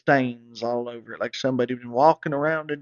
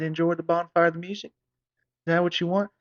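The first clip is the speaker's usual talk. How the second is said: echoing enjoy the bonfire back, higher and sarcastic; incredulous mock repeat; 5 semitones higher than usual. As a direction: throw his words back in disbelief. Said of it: "I said".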